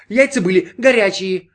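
high, loud waiter's retort; calling from a distance. A narrator's reading. Waiter contradicts Brin from safe distance, high and loud. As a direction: call it out from safely across the room. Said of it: "отозвался с безопасного расстояния официант".

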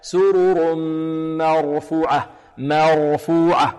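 slow مرفوعة with a thin, clear ma before heavy r; measured correct recitation, light م in مرفوعة. Shown as a demonstration. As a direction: chant مرفوعة keeping the m unemphatic. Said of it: "نحذر من تفخيم الميم".

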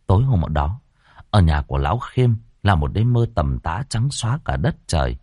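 usual speaking voice

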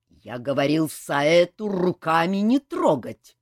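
slow, loud menacing rebuke. A narrator's reading. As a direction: colonel threatens the men, loud and slow. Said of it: "грозно повёл глазами полковник". (грозно, медленно и громко)

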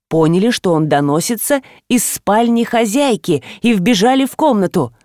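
ordinary delivery, nothing marked

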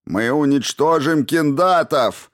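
slow, high, loud battle cry; shouted back. A narrator's reading. Someone in the mob shouts back defiantly. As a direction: shout it back, drawing the words out. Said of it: "крикнул кто-то в ответ".

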